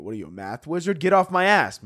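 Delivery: normal talking voice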